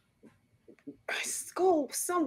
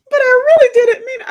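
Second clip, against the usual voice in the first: put-on high, loud tearful child's plea; crying child; 13 dB louder than usual. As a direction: plead like a sobbing little girl. Toddler crying.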